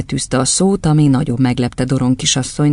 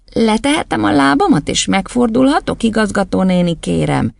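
pleading schoolboy; 8 semitones higher than usual. Acted. Boy on one leg asking the headmistress, high plea. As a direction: ask permission meekly, up high.

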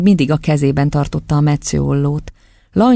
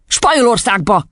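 raised, brusque dad's announcement; lightly voiced irritable father; curt father; 7 semitones higher than usual. A narrator's reading. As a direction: toss it out as a final answer.

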